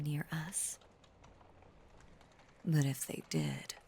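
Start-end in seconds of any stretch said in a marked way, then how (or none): 2.6-3.7 possessive threat. Acted. Creature threatens anyone coming near.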